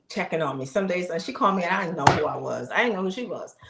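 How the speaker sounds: ordinary talk, nothing marked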